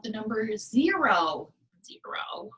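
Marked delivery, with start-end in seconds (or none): 1.8-2.5 whispered. Shown as a demonstration. Whispered number for kids.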